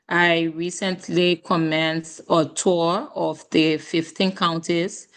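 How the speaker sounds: ordinary talk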